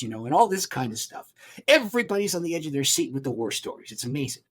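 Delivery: ordinary delivery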